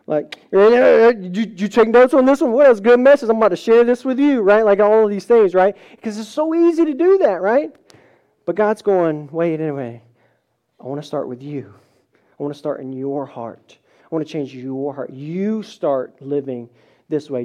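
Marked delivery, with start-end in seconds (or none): 0.5-4.4 louder, dismissive mock of a self-satisfied listener; smug listener. 9.3-17.5 lower, soft, tender voice of God; gentle God. Retold or imitated.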